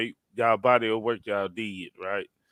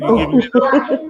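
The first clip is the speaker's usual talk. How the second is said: higher, laughing through the question; laughing tease; 11 semitones higher, 9 dB louder than usual. Real, not acted. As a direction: tease him while chuckling. Host teases the debater.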